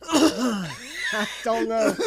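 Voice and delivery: loud, slow, exaggerated hacking cough; hammed-up cough. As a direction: cough it out like a comedy coal cough. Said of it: "when he coughs the coal".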